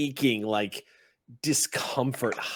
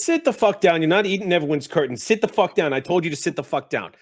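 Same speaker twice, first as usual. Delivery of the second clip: louder and higher, barked orders; angry red cap shout; 5 semitones higher, 8 dB louder than usual. Acted. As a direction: yell at the goblin to sit down. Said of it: "The red cap goes".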